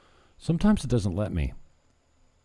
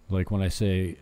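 normal talking voice

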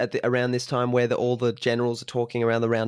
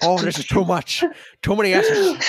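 high, loud mock Scottish accent; hammed-up Scottish actor struggling with all those S's; Sean Connery; 7 semitones higher, 4 dB louder than usual. Co-host mimics the actor defeated by his line, exaggerated Scottish accent. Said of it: "given his accent".

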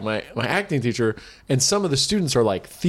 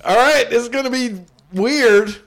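higher, louder, hammed-up nervous opener; mock nervous; 11 semitones higher, 5 dB louder than usual. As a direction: announce it with flustered bravado. Retold own nervous line.

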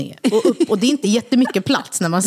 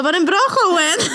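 teasing sing-song; 10 semitones higher, slower than usual. Retold or imitated. Teasing friends after the show.

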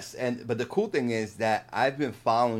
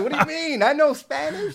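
much higher, indignant protest; mock indignant; 6 dB louder than usual. Guest retelling his reply to his wife, mock indignant. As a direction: play up the offended husband. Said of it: "I'm like".

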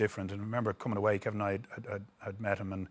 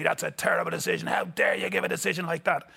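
raised, angry protest at the referee, a touch higher; mock roar at the official, pitched up; 7 semitones higher than usual. Roy roaring at the referee over a bad decision, raised voice. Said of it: "And he roared at the referee".

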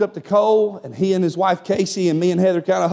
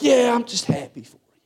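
higher, half-whispered through mock tears; imitated tearful; 4 semitones higher than usual. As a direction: act choked up, voice giving out. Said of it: "And he's crying".

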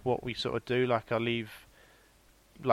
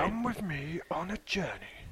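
slow, plummy English delivery; lightly posh and drawn out; 10 dB quieter than usual. Shown as a demonstration. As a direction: put on a posh storyteller voice, slowly. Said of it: "That's quite posh, really, isn't it?".

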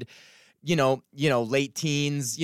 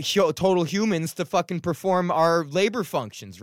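ordinary talk, nothing marked

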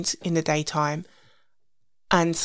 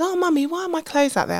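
put-on high, little-girl voice, lightly done; toddler voice; 11 semitones higher than usual. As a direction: play the puzzled toddler asking mum. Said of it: "And she said".

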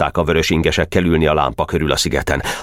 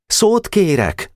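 much higher, slow request; timid boy; much slower than usual. Acted. Nemecsek asking the chairman to speak, formal. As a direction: ask for the floor formally.

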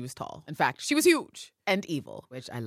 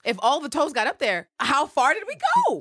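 incredulous; 11 semitones higher, 9 dB louder than usual. Real, not acted. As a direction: pile on the disbelief till it peaks high. Incredulous mock outrage at toes.

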